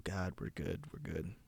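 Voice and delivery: usual speaking voice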